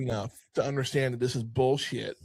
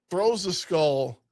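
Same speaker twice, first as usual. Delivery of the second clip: normal talking voice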